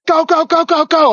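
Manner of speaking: high, loud rapid-fire urging; urgent radio call. Retold or imitated. Truck behind calling over the net, urgent shouting. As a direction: imitate a frantic call to get moving. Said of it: "calls up over the net".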